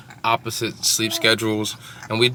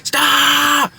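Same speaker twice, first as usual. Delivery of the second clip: high, loud crying yell; crying shout; 5 dB louder than usual. Retold or imitated. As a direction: wail it like a fed-up toddler. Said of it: "he's back there like".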